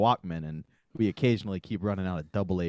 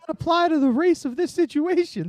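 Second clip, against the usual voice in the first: very high, louder falsetto principal; school principal; 7 dB louder than usual. Acted. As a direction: protest in a piercingly high principal voice. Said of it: "the principal of the school".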